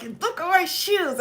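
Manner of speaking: high-pitched mock outrage; mad.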